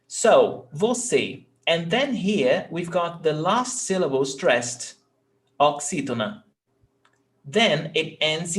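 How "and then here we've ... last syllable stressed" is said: usual speaking voice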